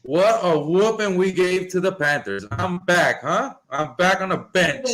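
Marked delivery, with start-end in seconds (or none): none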